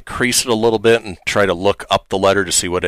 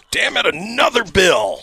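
very high, slow, strained outburst; mock anger; 11 semitones higher than usual. Co-host getting a bill envelope, high mock-angry cry. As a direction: squeal it out in hammed-up fury. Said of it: "the amount of anger I have".

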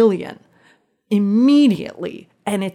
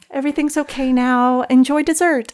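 bright, higher fake-cheerful announcement; forced cheer; 7 semitones higher than usual. Girlfriend telling party guests all is fine, forced cheer. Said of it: "I tell everybody".

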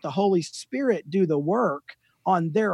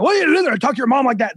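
higher, louder mock parental tirade; freak-out yelling; 6 semitones higher, 4 dB louder, much faster than usual. Father to his kids, mock freak-out yelling. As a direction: imitate a dad losing it. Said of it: "I start yelling at 'em and I freak out".

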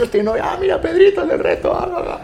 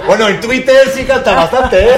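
slow words through real laughter, higher and louder; laughing; 8 semitones higher, 8 dB louder than usual. Real, not acted. Guest laughing at the singing senator joke, laughing. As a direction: laugh it out as you tease. Laughs joking about [name].

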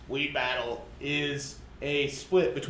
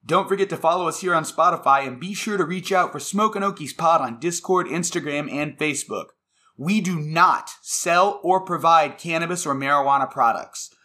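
loud, upbeat podcast outro read; announcer voice; 2 semitones higher, 10 dB louder than usual. Acted. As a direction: read it like a radio sponsor spot.

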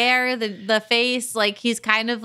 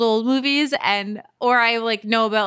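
ordinary talk

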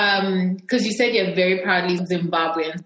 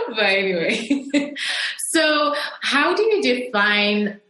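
ordinary talk

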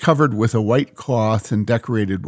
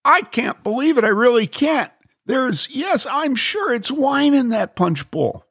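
much higher, amazed man; Bob, astonished; 14 semitones higher than usual. Acted. Bob insists to the lady it is wine, astonished. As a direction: disbelief bubbling over.

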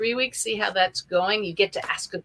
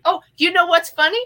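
excited; 11 semitones higher, 9 dB louder, faster than usual. Real, not acted. Excited aside on the boat name.

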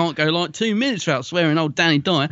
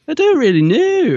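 excited outburst; 13 semitones higher, 6 dB louder than usual. Real, not acted. Sudden exclamation.

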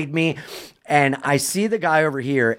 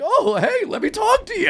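mock eager reply. Retold eager reply to fan.

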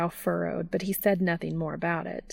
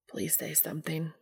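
hushed, half-whispered plea; soft, understated begging; pleading; 8 dB quieter than usual. A narrator's reading. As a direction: plead softly, barely voiced. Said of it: "he pleaded".

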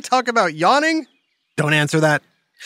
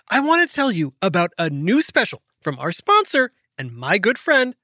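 normal talking voice

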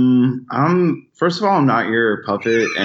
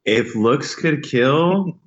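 slow dramatic; 4 semitones higher, much slower than usual. Pretend. Slow dramatic delivery of the joke.

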